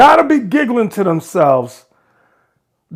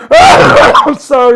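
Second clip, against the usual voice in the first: loud, slow laughing "sorry"; laughing apology; 5 semitones higher, 7 dB louder, slower than usual. Real, not acted. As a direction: crack up while apologizing. Host bursts out laughing.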